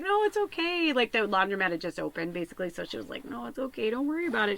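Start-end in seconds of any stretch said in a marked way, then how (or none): 0.0-0.9 imitated woman's high, lilting reassurance; reassuring. Laundromat helper to crying customer, high and reassuring. 3.3-4.6 imitated high-voiced comforting woman; reassuring. Laundromat helper answering an apology, high and reassuring.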